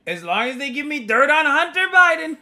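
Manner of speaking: high, loud Trump impression; mock Trump voice. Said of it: "He's gonna say".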